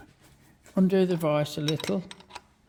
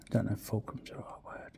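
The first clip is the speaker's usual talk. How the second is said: whispered aside to himself; whispering; 8 semitones lower, 14 dB quieter than usual. Real, not acted. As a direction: breathe it as a private aside. Quiet aside while machining.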